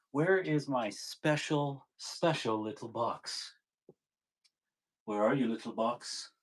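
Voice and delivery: soft, slow, coaxing call to a lost object; cooing to a box. Real, not acted. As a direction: murmur to the little box like a pet. Searching for his box, cooing to it.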